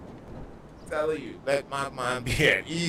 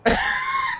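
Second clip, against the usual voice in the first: a burst of high laughter; shrieking laugh at his own punchline; high loud laugh; 7 dB louder than usual. Real, not acted. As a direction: crack up loudly.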